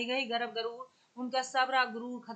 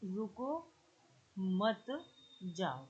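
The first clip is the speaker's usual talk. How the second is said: soft "रुको", a clear pause, then "मत जाओ"; रुको के बाद विराम; 4 semitones lower, 7 dB quieter, much slower than usual. Shown as a demonstration. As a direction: break after "रुको", dropping lower through "मत जाओ". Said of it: "अपनी ध्वनि को विराम दे दिया". ("रुको" पर ठहरकर धीमी आवाज़ में "मत जाओ")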